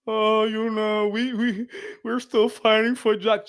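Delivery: low, slow mocking whine; mock whiny complainer. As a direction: parody a complainer begging for pity.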